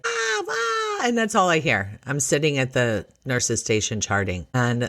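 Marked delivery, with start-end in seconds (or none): 0.1-1.0 very high mock wail; imitated teenage daughter's panicked squeal. Daughter hears she needs a scan, mock panicked wail.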